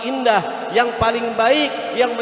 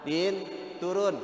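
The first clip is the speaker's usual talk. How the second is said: calm mild request; 3 semitones lower, 6 dB quieter, slower than usual. Retold or imitated. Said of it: "Emaknya datang".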